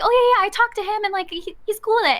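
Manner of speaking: high, rushed, casual reassurance; imitating breezy manager. Manager reassures startled employee about the boss, breezy. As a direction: rattle it off like it's no big deal. Retold manager brushing off concern.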